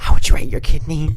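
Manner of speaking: loud mock interviewer question. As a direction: boom it out at full volume. Answers the call to talk louder.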